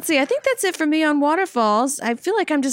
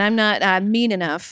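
ordinary delivery

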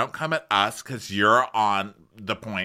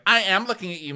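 mock insistent; 11 semitones higher, faster than usual. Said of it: "she's like".

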